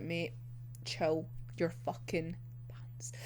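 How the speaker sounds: breathy whisper under her breath; whispered aside. Retold or imitated. Whispering to herself about Mori, fed up. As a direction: hiss it to yourself, barely voiced.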